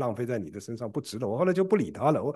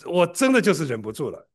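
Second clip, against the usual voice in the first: usual speaking voice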